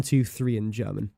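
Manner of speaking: ordinary talk, nothing marked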